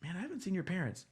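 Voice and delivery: a teacher noticing the parents are missing; surprised teacher. Retold or imitated. Sunday school teacher to the boy, surprised and quick. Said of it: "the teacher was like".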